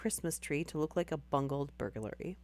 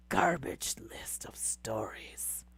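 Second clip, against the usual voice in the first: low, slow whispered put-down; whispered aside; 6 semitones lower, 4 dB quieter, slower than usual. Real, not acted. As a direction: mutter it under your breath, low. Mutters aside about the list.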